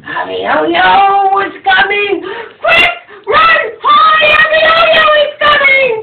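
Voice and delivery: high frantic alarm cries; panicked shout. Acted. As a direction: scream the warning to the whole town. Townspeople cry the alarm.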